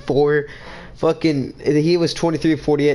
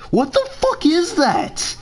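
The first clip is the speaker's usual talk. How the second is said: mock disbelief; 12 semitones higher, slower than usual. Real, not acted. Mocking opponent's hair.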